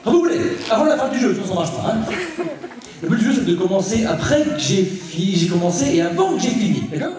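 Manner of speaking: eccentric stage magician. Acted. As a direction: ham it up for the crowd like a crazy showman. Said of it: "magicien excentrique et déjanté".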